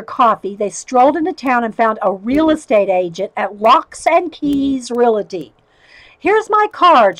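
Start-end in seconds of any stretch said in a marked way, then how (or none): 6.2-7.2 bright, cheerful and higher; perky realtor greeting. A narrator's reading. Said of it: "she said brightly".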